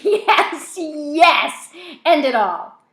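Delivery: loud, high, manic shrieking; laughing scream. A narrator's reading. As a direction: scream with crazed delight. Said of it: "Rat screams, laughs".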